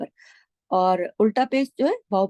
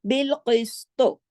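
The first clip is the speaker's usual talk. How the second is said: slow melodic recitation of बिल क़िस्तो; Quran recitation; slower than usual. Shown as a demonstration. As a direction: chant बिल क़िस्तो with heavy क़ and त. Recites verse phrase.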